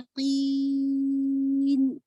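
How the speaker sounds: कीन as one very long steady ee, final न; nasal only at end. Shown as a demonstration. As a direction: hold कीन open, add nasality at the last moment. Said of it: "अब मैंने एंड में डाला है". (कीन बहुत लंबा, गुन्ना बिल्कुल आख़िर में)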